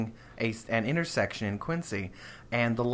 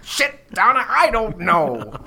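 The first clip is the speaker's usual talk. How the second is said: exasperated yell, high and loud; dad yelling impression; 9 semitones higher, 8 dB louder than usual. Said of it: "imagine hearing him say those words".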